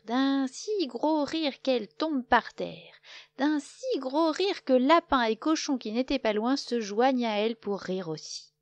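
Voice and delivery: plain reading voice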